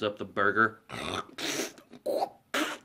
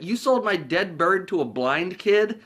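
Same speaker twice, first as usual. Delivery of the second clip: higher, slower, appalled question; mock outrage; 6 semitones higher, 4 dB louder than usual. Harry to Lloyd about the dead bird, mock appalled. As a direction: spell out the disbelief.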